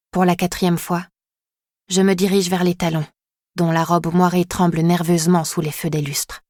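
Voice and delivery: usual speaking voice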